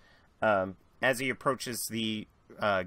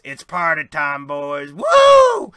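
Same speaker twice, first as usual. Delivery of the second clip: loud, high, reckless party shout; rowdy whoop; 5 semitones higher, 16 dB louder, slower than usual. Acted. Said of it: "and goes".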